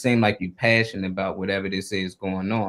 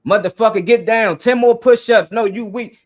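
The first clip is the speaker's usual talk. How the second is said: loud, high sergeant shout, punching each order; drill sergeant; 9 semitones higher, 9 dB louder than usual. Retold or imitated. Military sergeant drilling a recruit, imitated hard shouting. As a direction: yell orders like you're breaking in recruits. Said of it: "They talk to them in that tone".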